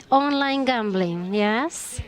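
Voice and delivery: ordinary talk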